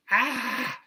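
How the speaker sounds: high, loud mock "ah"; sudden high cry, acted out; imitated cry. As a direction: burst out with a loud, high yell. Mimes reaction inside pyramid chamber.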